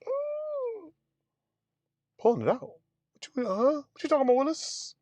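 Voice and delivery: high, startled disbelief; patient hearing the nurse's warning, high mock alarm. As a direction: squeal it out in comic shock. Panicking at catheter removal.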